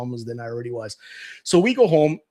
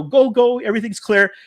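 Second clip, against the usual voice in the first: louder, higher call to go; 6 semitones higher, 6 dB louder than usual. Retold or imitated. Cousin waving the rider through, higher loud call. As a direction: call out the all-clear across the alley. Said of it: "He says".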